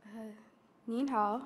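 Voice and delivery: ordinary talk, nothing marked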